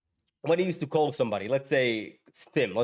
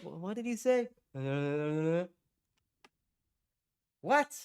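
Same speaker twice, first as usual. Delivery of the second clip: confused listener; 3 semitones higher than usual. Acted. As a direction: act a baffled call receiver, pitched way up. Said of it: "you're like".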